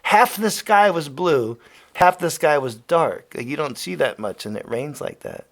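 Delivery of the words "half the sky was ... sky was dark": emphatic. Real, not acted. Savoring the weather.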